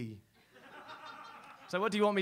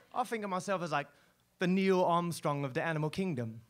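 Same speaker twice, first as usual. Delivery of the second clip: ordinary talk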